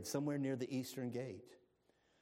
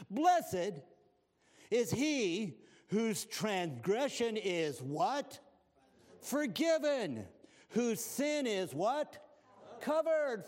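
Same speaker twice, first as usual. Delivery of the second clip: slow, louder, higher preaching read; fervent scripture reading; 6 semitones higher, 5 dB louder, much slower than usual. Acted. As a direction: lean hard on every word. Preacher reading psalm with fervor.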